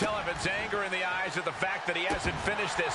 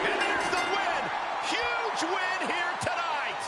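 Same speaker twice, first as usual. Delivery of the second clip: excited win call; 10 semitones higher, slower than usual. Real, not acted. Announcing the win.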